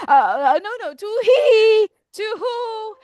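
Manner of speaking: high hooting owl; stammer, then hoot high and long; flustered owl. Acted. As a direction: owl making a panicked excuse. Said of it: "He said".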